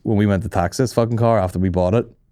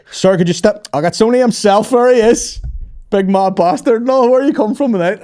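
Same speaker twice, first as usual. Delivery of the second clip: loud, high, delighted greeting; matey cop; 11 semitones higher, 5 dB louder than usual. Retold or imitated. Cop greeting comedian at a stop, loud chummy excitement. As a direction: hail a celebrity mate at full volume.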